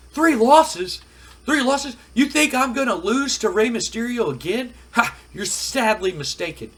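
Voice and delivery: high, loud, incredulous outburst; indignant acted wrestler, voice leaping up; outraged wrestler. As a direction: throw the words back in disbelief. Acted wrestler outraged at being doubted.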